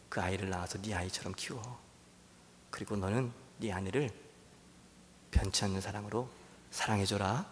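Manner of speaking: soft, tender fatherly voice of God; God's gentle voice. Acted. God answers Hosea, soft and tender. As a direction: speak low and kindly, like God comforting. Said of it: "하나님이 말씀하시는 거예요".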